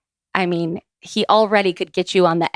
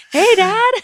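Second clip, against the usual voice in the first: much higher, louder squeaky little hello; sheepish greeting; 6 dB louder than usual. Retold or imitated. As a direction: play it small and sheepish. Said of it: "She was like".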